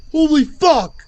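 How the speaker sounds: high, loud burst of disbelief; shocked shout. Real, not acted. Streamer stunned by screams in a video, shocked shout. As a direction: blurt it out in alarm.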